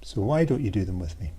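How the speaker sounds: ordinary delivery, nothing marked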